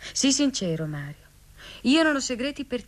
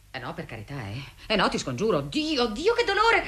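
ordinary delivery

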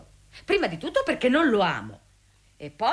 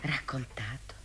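shocked whisper; 13 semitones lower, 10 dB quieter, slower than usual. Acted. Shocked reply to rumour.

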